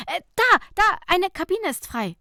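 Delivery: excited call. Acted. Nicola spotting a free changing cabin, excited call. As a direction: point it out eagerly.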